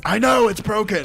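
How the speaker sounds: high, loud, slow exasperated yell; frustrated yell. Retold or imitated. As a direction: yell it out, fed up. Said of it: "and I was like".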